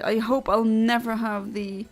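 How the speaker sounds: ordinary talk, nothing marked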